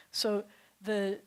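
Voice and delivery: usual speaking voice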